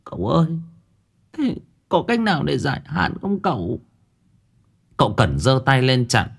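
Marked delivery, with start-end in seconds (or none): 0.0-3.8 high, soft and whimpering, close to tears; old woman begging the young master for help, whimpering; whimpering plea. A narrator's reading. Mother begging the spirit medium to lift the curse, whimpering and high. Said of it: "Rồi bà mếu máo".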